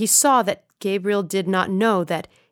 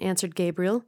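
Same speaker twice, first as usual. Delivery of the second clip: ordinary delivery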